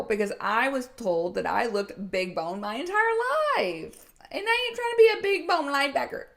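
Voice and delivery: high, fast, half-laughing protest; emphatic protest. Retold or imitated. Coach recalling her younger self refusing to be a linebacker, high and fast. Retelling protest against being big.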